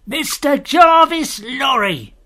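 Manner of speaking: slow, high, drawn-out shout; called from afar. A narrator's reading. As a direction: holler the name slowly across a distance. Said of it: "A man's voice called from the mist".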